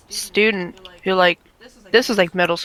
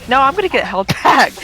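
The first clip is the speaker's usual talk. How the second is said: laughing through a quote; 5 semitones higher, 5 dB louder than usual. Retold or imitated. Retelling the student who wanted held back, laughing. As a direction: quote the kid while breaking into a laugh.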